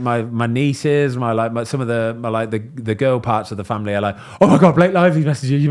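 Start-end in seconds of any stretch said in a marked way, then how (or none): 4.4-5.7 put-on fast, high, loud girly gush; excited squeal. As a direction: gush like starstruck teens. Imitating family girls' excitement.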